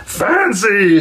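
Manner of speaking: high, bright exclaim of delight; troll delighted with his tuxedo. Acted. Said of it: "said the troll with delight".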